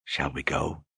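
soft, breathy invitation. Acted. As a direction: breathe the question softly. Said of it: "asked in a breathy voice".

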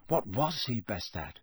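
usual speaking voice

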